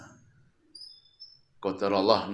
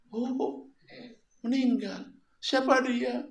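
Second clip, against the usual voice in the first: put-on high shocked old man; 14 semitones higher than usual. Blind man learns of death.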